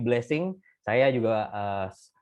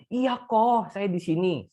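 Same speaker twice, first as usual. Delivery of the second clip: higher pitched, with a mild hint of held-in crying; the wife answering bravely through her tears; a touch of choked-up reassurance; holding back tears; 6 semitones higher, slower than usual. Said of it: "sambil menahan nangis ya".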